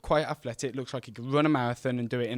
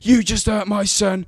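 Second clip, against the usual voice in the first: louder, higher angry accusation; hurling abuse; 8 semitones higher, 8 dB louder than usual. Retold or imitated. Angry mother still yelling at the boy, louder and higher. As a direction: keep hurling it at him. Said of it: "hurling abuse at me".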